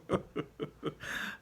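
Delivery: breathy high giggle; soft high giggling. Acted. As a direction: giggle to himself.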